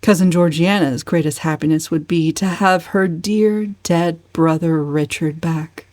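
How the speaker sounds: ordinary delivery, nothing marked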